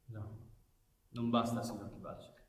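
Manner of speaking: almost breathless, conceding softly; hushed resignation. Acted. Commissario conceding to Kibacic, hushed and resigned. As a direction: let it fall away to a near-hush. Quiet resigned close of argument.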